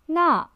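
那 in Mandarin fourth tone, falling sharply from high. Shown as a demonstration. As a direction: snap 那 down from the top. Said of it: "also the fourth tone".

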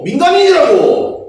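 loud, slow, high protest; indignant shout. Acted. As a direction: stretch it out in outrage. Spoiled heir protests to instructor. (느리고 높게 억울한 외침)

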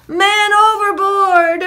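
high, loud, drawn-out shout; alarm call. A narrator's reading. Sailor warns crew in the storm, shouted alarm. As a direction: raise the alarm to all hands.

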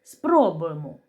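ordinary delivery

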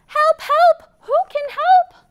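high, loud little duck crying help; duck calling for help. Acted. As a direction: squeak out a panicky call for help. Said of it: "What does the duck say?".

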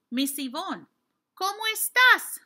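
high, loud eager question; excited child asking. Retold or imitated. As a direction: call out the question like an excited student. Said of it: "if you were to ask me".

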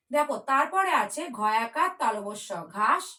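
ordinary talk

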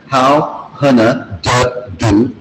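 "How hana de do" slowly, each word separate; slow teaching demo of "How hana de do". Shown as a demonstration. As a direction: space out "How hana de do" for a learner. Teacher breaks phrase down word by word.

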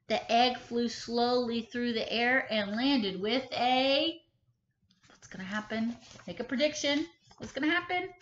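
ordinary delivery, nothing marked

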